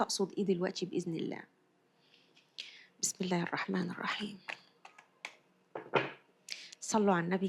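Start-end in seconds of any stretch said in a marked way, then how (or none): 3.0-4.4 soft breathy whisper of the basmala; whispered prayer. Real, not acted. Reader to viewers, opening the reading in a whisper. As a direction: breathe the words out, no voice.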